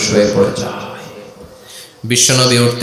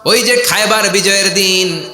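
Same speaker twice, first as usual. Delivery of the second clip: high, sustained sermon chant; impassioned preaching; 10 semitones higher than usual. Acted. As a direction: carry the tale on a high ringing note.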